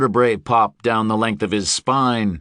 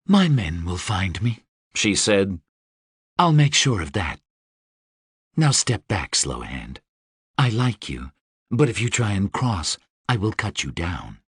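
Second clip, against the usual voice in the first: sultry Deva voice; 2 semitones lower than usual. Acted. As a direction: purr it like a dangerous winged queen. Deva character voice.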